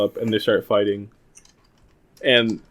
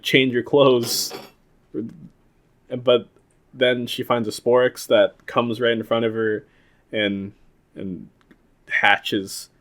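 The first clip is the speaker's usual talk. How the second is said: ordinary talk, nothing marked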